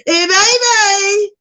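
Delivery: high, loud, drawn-out call; hammed-up sassy greeting. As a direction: sing it out high and slow like a cheeky flirt.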